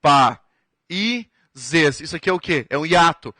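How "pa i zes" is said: pa, i, zes said as separate beats with pauses; spaced syllables; syllable split. Shown as a demonstration. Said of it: "Aqui vamos separar em sílabas".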